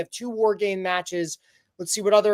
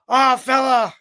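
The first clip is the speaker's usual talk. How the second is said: put-on loud, higher Irish-accented burst; mock Irish accent; 6 semitones higher, 7 dB louder, slower than usual. Imagining the wrestler's backstage promo, mock Irish accent. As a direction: belt it out in a playful Irish brogue. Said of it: "not gonna be in an Irish accent".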